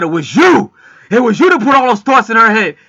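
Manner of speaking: loud, high, accusing shout; furious accusation. Retold or imitated. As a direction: hurl the blame at him. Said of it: "they started fighting".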